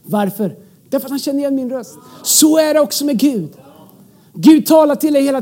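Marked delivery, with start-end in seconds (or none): none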